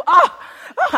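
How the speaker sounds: mock moan. Mocking TV moans again.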